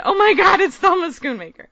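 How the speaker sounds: high, loud starstruck mock scream; screaming inwardly. Fan meeting Thelma in her head, screaming. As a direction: squeal it like a thrilled fan. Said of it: "I'm, like, screaming in my head".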